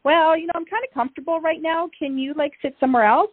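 lightly mock a lazy teen on the couch; whiny kid talking back. Kid on the couch asks parent to sit elsewhere, mock whiny. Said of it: "Like, the way my kids talk to me".

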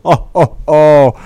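Santa belly laugh; hearty ho ho ho; jolly ho ho ho. Acted.

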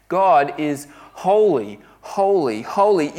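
each "holy" hit hard and loud, set apart by itself; emphatic repetition. Real, not acted. Preacher stressing God's holiness to the church, emphatic on every "holy". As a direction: punch "holy," "holy," "holy." as separate emphatic beats. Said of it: "You can't emphasize it enough".

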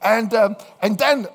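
normal talking voice

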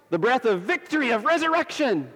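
high, ringing proclamation of victory; triumphant preaching. Real, not acted.

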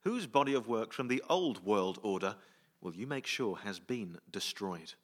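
usual speaking voice

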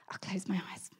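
soft whispered surrender; whispered giving in. Retold or imitated. Replying to God's voice on the walk, whispered. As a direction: whisper it, reluctantly agreeing.

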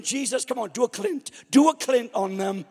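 loud, half-sung urging; cinema-goer loudly urging Jesus to fight back; urging cry. Retold or imitated. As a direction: egg him on like a crowd chant. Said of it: "And I'm saying".

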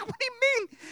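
very high, loud squeal; hammed-up incredulous shriek; panicked disbelief. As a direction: squeal the question in mock panic. Panicked question to nurse, retold.

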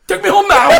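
high, loud demand through laughter; laughing mock demand. Son mimics dad after the walk, high loud laughing. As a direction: order it triumphantly like a stubborn dad. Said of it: "and he goes".

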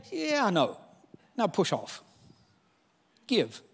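a quiet, unhurried answer; Jesus gently insisting. Retold or imitated. Jesus answering the worried believer, soft and calm. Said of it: "you go".

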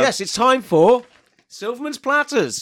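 loud, high, slow showman's announcement; hammed-up show intro; segment announcer. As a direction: announce it with big drawn-out fanfare. Announcing segment to ladies and gentlemen.

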